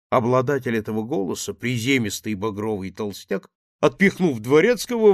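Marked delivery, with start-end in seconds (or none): none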